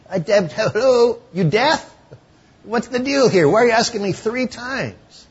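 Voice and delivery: high, loud mock exasperation; an annoyed disciple snapping back; exasperated Peter. Peter's exasperated retort to Jesus.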